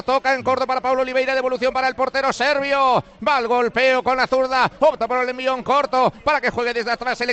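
fast, even-pitched radio commentary; live play-by-play. Real, not acted. Commentator updates listeners on play and score, brisk live play-by-play. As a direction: reel off passes, clock and score.

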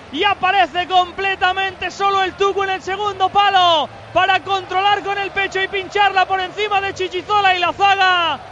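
high, loud, stretched-out goal recap; breakneck goal commentary. Real, not acted. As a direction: keep shouting with joy over the replay. Goal replay after the score.

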